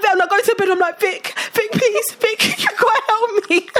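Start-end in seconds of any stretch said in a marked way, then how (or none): 1.0-3.7 high tearful begging; desperate pleading. Retold or imitated. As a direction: beg God through tears. Begging sibling for help.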